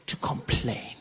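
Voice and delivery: whispered threat; soft whispered resolve. Real, not acted. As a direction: hiss it under your breath. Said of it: "not gonna sit around".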